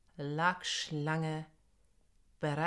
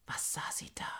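whispering; 5 dB quieter than usual. A narrator's reading. Narrator hushes at night discovery.

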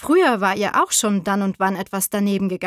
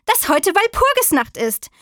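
high, worked-up little witch complaint; annoyed little witch; 9 semitones higher, 4 dB louder than usual. A narrator's reading. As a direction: pour out the grievance, pitched high. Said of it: "Es ärgert mich". (hoch und verärgert geklagt)

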